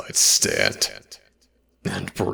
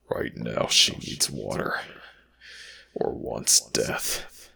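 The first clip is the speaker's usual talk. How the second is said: normal talking voice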